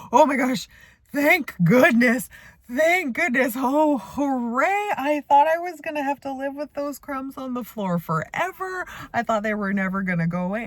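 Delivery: high, hammed-up relieved cry; mock relief. Mom teasing her son over swept-up crumbs, joking overdramatic relief. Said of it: "I started making a really big joke about it".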